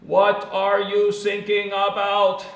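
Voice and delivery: put-on loud, high, slow German-accented radio reply; puzzled German operator, stretching each word. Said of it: "and it's in Germany".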